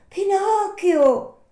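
high, loud puppet voice; Pinocchio calling up. Acted. Puppet asking for the Fairy at night, high calling voice. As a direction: call up loudly in a high puppet voice. Pinocchio calls up his name.

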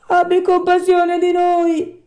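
very high, loud, slow wail; whining plea. Acted. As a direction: beg for pity like a wretched fox. Fox begs for pity.

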